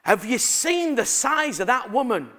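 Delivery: imitated little girl, high and loud; loud child's blurt. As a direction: blurt it out for everyone to hear. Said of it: "like, loud".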